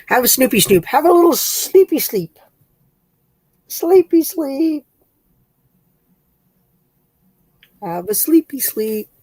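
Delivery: slow, high cooing. Real, not acted. Soothing the pet to sleep in slow, high baby talk.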